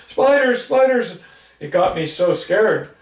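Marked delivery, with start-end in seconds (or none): 0.1-1.2 much higher, louder, frantic; terrified cry. Retold or imitated. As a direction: brother swatting imagined spiders. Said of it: "brushing them off".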